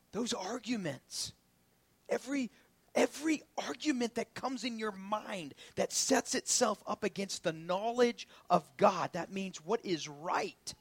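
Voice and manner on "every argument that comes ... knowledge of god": ordinary delivery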